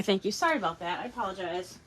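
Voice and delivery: ordinary delivery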